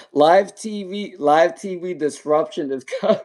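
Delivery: high-pitched words squeezed out through laughter; laughing hard. Real, not acted. Streamer reading the chat aloud, helpless with laughter. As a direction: barely get it out while cracking up. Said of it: "Thank you guys for the laugh".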